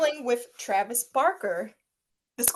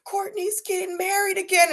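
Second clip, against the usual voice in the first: mock whine; 9 semitones higher than usual. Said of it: "it's like".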